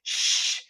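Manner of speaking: soft voiceless shh; quiet shush. Shown as a demonstration. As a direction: shush a noisy little sibling softly. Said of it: "do you tell them".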